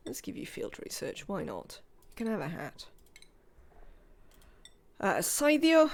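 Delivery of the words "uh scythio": a lightly put-on Welsh lilt, higher; a hint of Welsh accent on the name. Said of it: "in my best Welsh accent".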